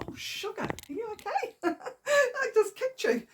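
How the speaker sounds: high, laughing sorry to the dog she just kicked; pet talk. Real, not acted. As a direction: apologise to the pet in a squeaky, fond voice, laughing. Talking to the pet after kicking it.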